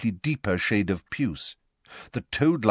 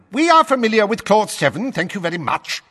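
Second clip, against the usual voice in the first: loud, higher cutting snarl; 7 dB louder, faster than usual. A narrator's reading. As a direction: slam the interruption shut. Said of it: "snarled Fudge".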